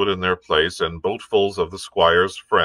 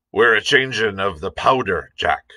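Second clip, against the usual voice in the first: rough sailor voice. Acted. Sailor answers in voice.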